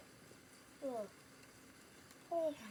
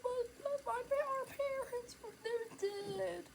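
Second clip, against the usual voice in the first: put-on high, slow, sobbing girl voice; crying girl; 13 semitones higher, 9 dB louder, slower than usual. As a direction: choke out the news through tears. Girl says parents are dead.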